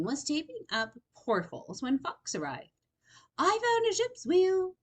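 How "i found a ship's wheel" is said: much higher, proud news; eager announcement. A narrator's reading. Fox arriving, announcing his find to Franklin. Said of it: "announced Fox".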